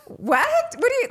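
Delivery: loud, high-pitched incredulity; shocked disbelief. Retold or imitated. Reacting to being told to hit something, shocked.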